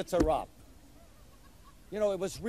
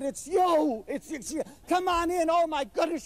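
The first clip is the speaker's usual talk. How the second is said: thrilled wife; 9 semitones higher than usual. Retold or imitated. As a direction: gush with overjoyed disbelief. Wife sees him at door.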